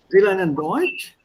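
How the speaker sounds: rising question on "Deutsch"; questioning rise. Shown as a demonstration. Teacher reading it with a question mark, rising question tune. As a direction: say "Sie lernen Deutsch" as a question, lifting the last word. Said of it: "if I put a question mark here".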